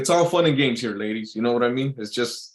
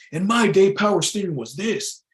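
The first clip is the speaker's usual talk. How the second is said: put-on higher-pitched old-timer voice; old man boasting, pitched up; 8 semitones higher, 3 dB louder than usual. Old timer showing a young driver, boastful old-timer voice. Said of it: "he was an old man".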